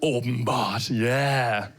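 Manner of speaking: low, relished Danish word, then a contented yeah; deeply satisfied by the sound of it; savouring, satisfied. Shown as a demonstration. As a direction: roll it round like a good mouthful. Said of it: "that's a satisfying word to say".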